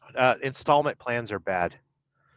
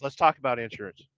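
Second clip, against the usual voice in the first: ordinary talk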